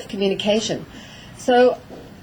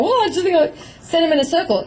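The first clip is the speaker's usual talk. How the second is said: mock careless handler; 8 semitones higher, faster than usual. Mocking a brainless handler.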